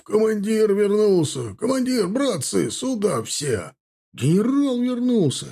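high, excited calls to the comrades; joyful calling out. Acted. Old soldiers calling each other out to greet the general, high excited shouts. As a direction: shout the news to everyone inside. Veterans greet returned commander.

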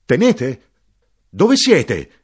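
very high and very loud calling; desperate shout. Acted. Narrator shouting for the soldier who has vanished. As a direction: yell out into the dark, growing frantic.

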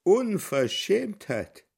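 drawn-out, high, outraged cry; indignant outburst. Acted. A shocked onlooker voicing outrage, drawn-out cry. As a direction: stretch the word out in scandalised disgust. Indignant voices in dream sequence.

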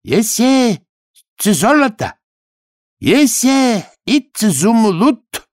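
high, slow, pompous broken Russian; self-important. A narrator's reading. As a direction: puff up and boast. Khodya boasts of the ring.

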